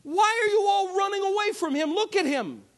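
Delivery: high, fast disbelieving question; incredulous David. Retold or imitated. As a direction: ask the soldiers in amazement. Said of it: "looks around at everybody and said".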